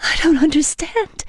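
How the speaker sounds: high, tearful confusion; bewildered through tears; weeping. A narrator's reading. Woman weeps to vampire, tearful. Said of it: "I said, weeping".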